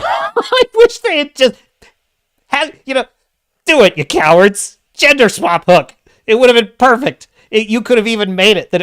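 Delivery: high, loud exasperated rant; laughing rant. Real, not acted. Reviewer ranting that the studio should gender swap Hook, loud and high.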